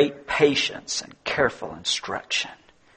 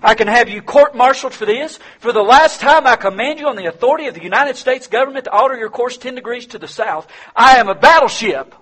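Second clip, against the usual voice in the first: captain barking orders, higher and louder; captain on the radio growling orders, higher and louder; growled orders; 9 semitones higher, 5 dB louder than usual. Acted. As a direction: threaten the private with rank. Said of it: "the captain growled back over the radio".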